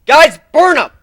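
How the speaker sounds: loud, high yelled order. Acted. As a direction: yell it back over your shoulder. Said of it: "I yelled over my shoulder".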